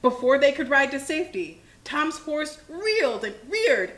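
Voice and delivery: ordinary delivery, nothing marked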